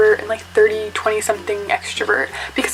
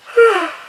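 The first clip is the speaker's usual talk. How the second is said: yawn; 10 semitones higher, 6 dB louder than usual. Real, not acted. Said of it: "it was just a yawn".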